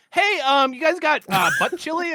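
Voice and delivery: high goofy prank-caller voice; funny voice. Retold or imitated. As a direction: ham up a dopey caller asking for a fake game. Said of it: "him doing a funny voice".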